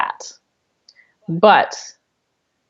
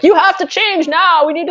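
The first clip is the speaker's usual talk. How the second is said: mock attack; 9 semitones higher than usual. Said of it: "hammer on the door and be like".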